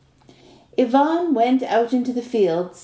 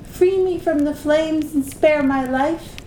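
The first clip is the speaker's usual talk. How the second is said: high, pleading call; crying snake woman; 7 semitones higher than usual. A narrator's reading. Snake begging Ivan to free her, high plea. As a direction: plead with the stranger for rescue. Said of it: "the snake cried".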